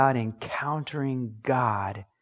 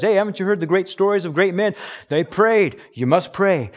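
fast, higher and louder mock lecture; preachy parent; 5 semitones higher, 4 dB louder than usual. Parent lecturing kids about great men who prayed, mock preachy. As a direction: hurry through a parent's sermon.

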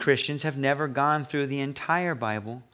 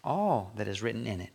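All said with a drop, quieter; soft emphasis on all; 3 semitones lower, 7 dB quieter than usual. Shown as a demonstration. Pastor repeating the verse quietly to stress all. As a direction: lean on the word All softly. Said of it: "not some of it".

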